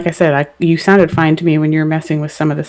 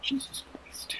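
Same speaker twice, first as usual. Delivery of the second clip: whisper; 8 semitones higher, 25 dB quieter, slower than usual. Real, not acted. Reacting after unplugging the headphones.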